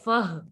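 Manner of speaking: फह short, closed by a breathy h; sounded final h. Shown as a demonstration. Teacher joins fa and ha for students, sounded final h. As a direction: cut the vowel of फह with an audible h. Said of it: "फिर क्या बना".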